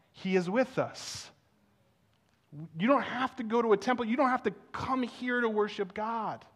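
rising, building preacher's call; preaching. Acted. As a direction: preach it, lifting toward the crowd. Opening of the sermon.

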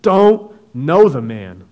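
loud, slow oath; swearing denial. Acted. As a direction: deny it with an oath, slowly and loud. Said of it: "He denied it again with an oath".

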